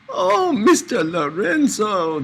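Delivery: high, slow, drawn-out coo; flattered coo. Acted. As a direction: gush like a flattered woman. Dika flattered by compliment.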